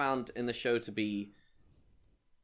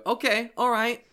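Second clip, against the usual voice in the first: louder, higher brisk okay; mock comic moving on; 10 semitones higher, 7 dB louder than usual. Comic cutting off the audience laugh, louder brisk moving on. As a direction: cut the laugh short and push on. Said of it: "you go".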